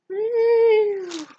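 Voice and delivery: high, drawn-out "woo"; celebratory whoop. Real, not acted. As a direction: let out a long high-pitched woo. Cheering her finished progress report.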